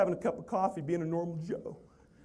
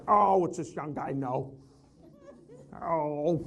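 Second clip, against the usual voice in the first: slow, dismissive grumble; skeptical old salesman; slower than usual. Retold or imitated. Older salespeople dismissing the young speaker, slow scoffing voice. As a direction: scoff like a jaded veteran. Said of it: "thought they were gonna be like".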